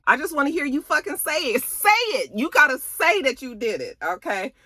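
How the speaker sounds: put-on a boyfriend yelling for a confession; mock yelled demand. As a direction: shout it at her to confess. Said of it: "he was like".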